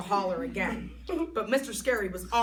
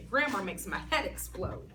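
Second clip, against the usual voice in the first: ordinary delivery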